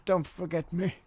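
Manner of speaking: high, pitiful mock plea from the old dog; pleading dog voice. Voicing the dying dog.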